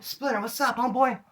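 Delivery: high, eager hey-man hail; the friend shouting back happily; excited greeting. Retold or imitated. Homeboy greeting Splinter from his car, high and excited.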